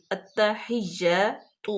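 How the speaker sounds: "التحیات" very slow, the alif drawn long; stretched "التحیات"; lengthened vowel. Shown as a demonstration. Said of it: "لمبا کریں گے".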